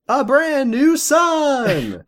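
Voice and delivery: joking that her son is another prize; mock game-show excitement. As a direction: stretch it out like a prize reveal. Same mock joke about son.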